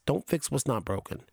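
ordinary talk, nothing marked

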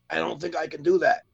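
put-on punchline in the patient's old funny voice; odd transplanted voice. Doctor refusing the patient after the transplant, in an abnormal put-on voice. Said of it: "I've been talking like this my whole life".

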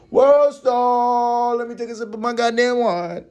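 put-on voice. Putting on a voice.

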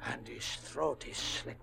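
a husband telling his wife of the murder, slow and hushed; horrified hush. Acted. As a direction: let it sink in quietly, with dread. Husband discovers corpse, horrified.

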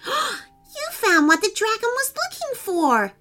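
high, wide-eyed astonishment; amazed. A narrator's reading. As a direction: gasp it out in awe. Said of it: "said an amazed Rain Kitty".

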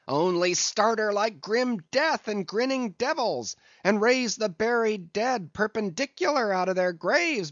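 high, driving exhortation; sustained high-pitched rallying cry. A narrator's reading. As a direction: whip the crew up in a high calling voice. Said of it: "cried Stubb".

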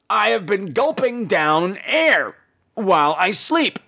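ordinary delivery

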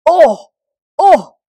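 loud, high cry of pain; the sky cries out in pain from the peaks. Acted. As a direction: cry out as the sharp peaks hurt. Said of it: "cried the Sky".

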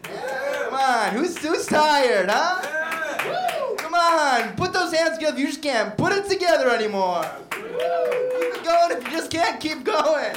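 high, shouted rallying call; mock crowd hype. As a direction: whip the crowd up like a hype man. Comic hypes up the crowd.

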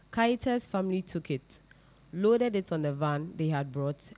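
ordinary talk

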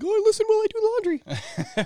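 high squeaky mom impression; mom friend voice. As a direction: gush like a mom doing laundry. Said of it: "mom friends, you know, that are like".

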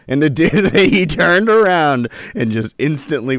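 can't stop laughing at his own story. Real, not acted. To his co-host about the walking-away zookeeper, laughing out the punchline.

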